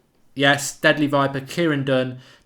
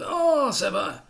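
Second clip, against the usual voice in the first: much higher, slow Italian-ish drawl; much higher slow cartoon Italian; Italian alien voice; 8 semitones higher, much slower than usual. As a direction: stretch it out like the slave keeper, a bit rubbish. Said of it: "It's basically Italian with a bit of".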